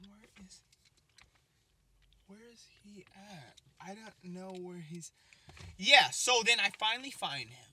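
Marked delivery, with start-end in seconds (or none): 0.1-5.1 hushed whisper, searching. Retold or imitated. Searching for his friend, whispering to himself. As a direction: whisper it under your breath. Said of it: "I'm like".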